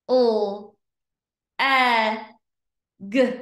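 L, e, g as separate letter sounds; long gaps between L, e and g; sounded out slowly. Shown as a demonstration. Teacher pointing to each dot, L-e-g sounded out. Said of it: "read each word slow".

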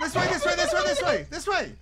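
loud, high frantic calling; being chased. Real, not acted. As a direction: shout directions while fleeing. Being chased, leading escape.

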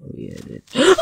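sharp breathless startled whisper; scared whisper. Real, not acted. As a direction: gasp it out in fright. Creeper jump scare.

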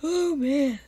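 usual speaking voice

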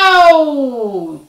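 loud, high drawn-out "ow" wail; long falling howl of pain; yelp of pain. A narrator's reading. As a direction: cry out as you fall. Leopard falls into the trap.

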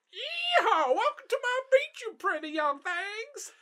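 put-on very high, loud old-timer yell; old man voice. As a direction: whoop like an old hillbilly codger. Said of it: "Please do not do an old man voice".

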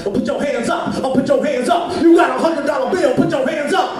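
mock DJ shouting into the crowd; bossy club DJ. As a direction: bark orders at the crowd like a club DJ. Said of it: "They're so bossy".

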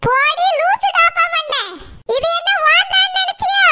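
fish-seller yelling at Saroja to stop being silly, high and loud; angry fish-seller. Acted. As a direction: tear into her like a furious fish-seller.

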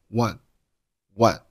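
"what" with the t held silent; unreleased final t. Shown as a demonstration. Teacher showing learners "what" with a silent final t. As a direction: stop the t in "what" without releasing it. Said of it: "不发声音".

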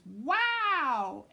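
be amazed by the package; excited wow. Acted. Boy opening NASA package, thrilled shout. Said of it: "he says".

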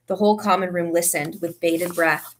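ordinary delivery

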